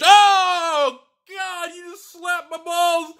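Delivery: high-pitched cry of disbelief; shocked outcry. Real, not acted. Card opener reacting to a huge pull, shocked high cry. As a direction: burst out loud, then stretch it out high.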